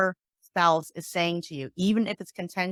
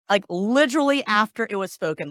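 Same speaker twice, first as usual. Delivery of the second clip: ordinary talk, nothing marked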